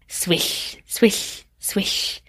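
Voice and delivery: soft breathy swish, swish, swish; whispered swish sound. Retold or imitated. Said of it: "swishing it around".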